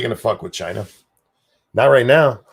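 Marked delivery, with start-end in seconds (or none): 1.7-2.4 louder, firm first take; emphatic. Real, not acted. Streamer insisting nobody will touch China, louder and firm. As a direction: push the line out as a firm declaration.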